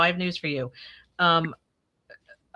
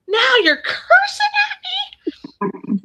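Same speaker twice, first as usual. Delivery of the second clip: much higher, very slow, thrilled; mock delight, laughing; 6 dB louder, much slower than usual. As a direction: squeal with fake joy at the insult. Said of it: "he's like".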